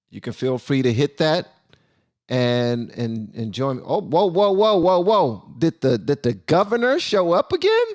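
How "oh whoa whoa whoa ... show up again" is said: high-pitched excited double take; a run of whoas rising into delighted disbelief; real surprise. Real, not acted. Host spots the governor back on stream, surprised and high-pitched.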